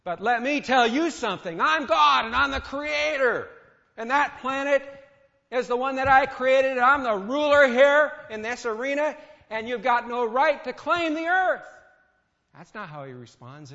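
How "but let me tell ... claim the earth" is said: much louder and higher, a mock furious tirade; angry rant. God angrily claiming the earth from Satan, mock furious shouting. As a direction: thunder at him, pulling rank. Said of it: "fly off the handle then and get angry".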